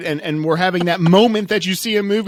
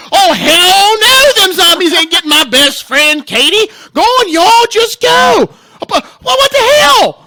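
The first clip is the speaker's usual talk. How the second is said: high, shouted put-on drawling accent; big, mock-outraged accented yell; ridiculous loud accent; 10 semitones higher, 6 dB louder, slower than usual. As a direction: holler indignantly in a silly exaggerated accent. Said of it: "that ridiculous accent that comes out".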